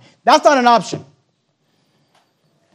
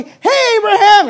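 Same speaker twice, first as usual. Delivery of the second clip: cheery greeting; 11 semitones higher, 7 dB louder, slower than usual. Acted. Calling out to a friend cheerfully.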